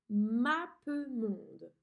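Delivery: mappemonde split slowly into syllables; very slow dictation. Shown as a demonstration. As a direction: spell out mappemonde by ear. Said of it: "Appuie sur pause et écris ce mot". (mappemonde syllabe par syllabe)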